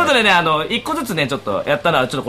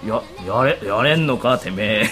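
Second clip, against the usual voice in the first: Gintoki voice; 7 semitones lower than usual. Pretend. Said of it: "まずは低いんだよ".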